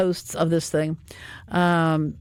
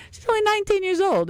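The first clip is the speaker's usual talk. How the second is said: Nineteen comes out much higher, amazed; surprised; 13 semitones higher than usual. Real, not acted. Host telling listeners the skater's age, amazed.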